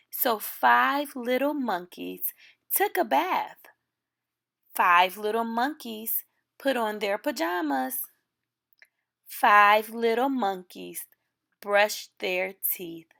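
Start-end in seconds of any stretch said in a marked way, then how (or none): none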